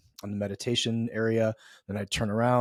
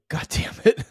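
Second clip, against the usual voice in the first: higher, louder burst of frustration; mock exasperation; 9 semitones higher, 6 dB louder than usual. East Coaster impatient with silence, mock exasperation. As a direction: curse in half-amused impatience.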